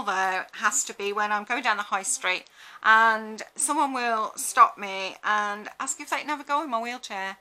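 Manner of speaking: usual speaking voice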